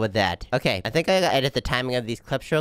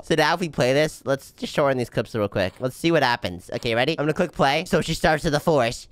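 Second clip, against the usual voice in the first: ordinary delivery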